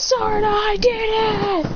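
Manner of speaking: high, slow, stretched announcement; triumphant calling out. Retold or imitated. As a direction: crow it to your sister. Boy calls sister about his win.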